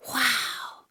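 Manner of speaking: ordinary delivery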